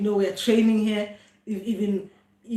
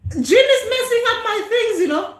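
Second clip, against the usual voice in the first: high-pitched, laughing through the words; half-exasperated giggling complaint; 10 semitones higher, 5 dB louder than usual. Real, not acted. Laughing about son's interruption.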